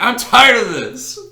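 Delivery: high, loud, slow shout of fed-up defiance; a classmate hams up a sudden tantrum in band class; mock outburst. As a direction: yell it like a kid kicking over a music stand.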